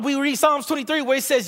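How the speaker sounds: usual speaking voice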